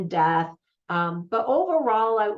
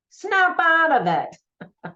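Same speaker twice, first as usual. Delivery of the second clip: much higher, louder, slow Italian-American snap; 9 semitones higher, 6 dB louder, much slower than usual. Retold or imitated. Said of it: "smacks him, you know, in the face and goes".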